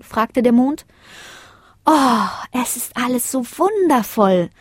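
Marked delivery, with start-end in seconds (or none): 1.9-4.5 slow, high, awed delight; enthusiastic. A narrator's reading. Lina admires the coloured clouds, enthusiastic. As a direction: draw it out with wonder. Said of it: "sagte Lina ganz begeistert".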